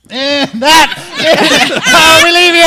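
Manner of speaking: loud sign-off through laughter; laughing shout. Real, not acted. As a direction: laugh while shouting the goodbye.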